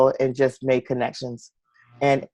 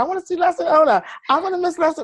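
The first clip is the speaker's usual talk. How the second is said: put-on high, fast, whiny kid voice; mock whiny self; faster than usual. Quoting her upset self after missing the flight, in a whiny kid voice. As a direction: whine it like a sulky child. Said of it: "'Cause I'm like".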